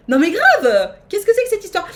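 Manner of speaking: loud indignant cry; indignant outburst. Real, not acted. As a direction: protest at the game loudly. Outraged at game.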